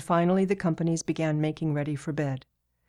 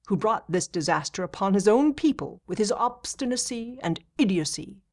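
impatient; 5 semitones higher, 4 dB louder than usual. Acted. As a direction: snap it out, fed up with the subject. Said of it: "said impatiently".

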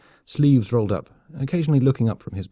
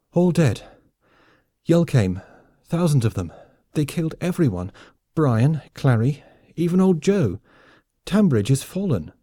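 higher, urgent broken phrases; distraught report; 5 semitones higher, 3 dB louder than usual. A narrator's reading. Soldier telling his friend of the massacre, distraught. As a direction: deliver the awful news in short bursts.

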